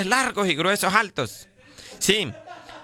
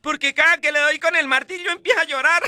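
put-on child's voice; 8 semitones higher than usual. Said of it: "El niño a la mamá".

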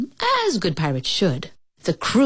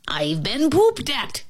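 mild narrated yell; 6 semitones higher than usual. A narrator's reading. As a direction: cry out slowly in higher pitch without volume. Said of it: "he yelled".

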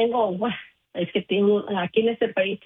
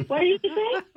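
mock grandkid; 10 semitones higher than usual. Imitating grandkids' English.

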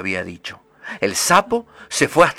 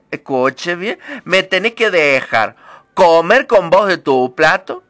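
bossy frog; 7 semitones higher, 6 dB louder than usual. Acted. Frog sets conditions.

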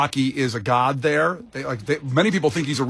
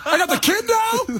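much higher, whiny mock Rocky; mock Rocky rant. Host mocking Rocky's rant to Kenzie, high and whiny. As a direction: ham up Rocky's complaint.

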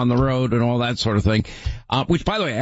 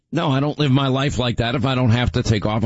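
ordinary talk, nothing marked

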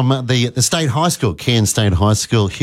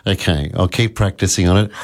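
usual speaking voice

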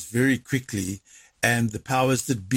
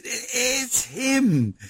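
wife's stunned words, pitched way up; awestruck wife; 12 semitones higher than usual. Retold or imitated. Husband imitating his startled wife at 2 am, voice high. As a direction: mimic his wife's hushed amazement. Wife hands over late-night phone call.

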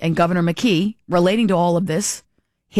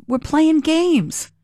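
slow, much higher, mocking sing-song; sneering slow we're playing games; sarcastic drawn-out games; 9 semitones higher, much slower than usual. Real, not acted. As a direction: stretch it out mockingly, pitch way up. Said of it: "That's my take".

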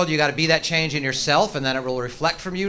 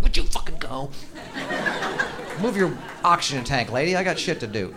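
impatient mutter. Retold or imitated. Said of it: "in my impatient head I'm like".

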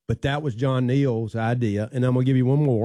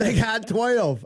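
high, slow frantic cry; excited yelling; 10 semitones higher, much slower than usual. Retold or imitated. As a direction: plead it like a frantic coach. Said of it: "I'm going".

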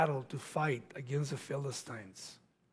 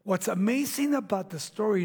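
usual speaking voice

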